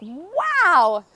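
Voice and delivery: loud, high, drawn-out wow; awed exclamation. Retold or imitated. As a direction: burst out in amazement at a revelation. Retold moment of huge realization.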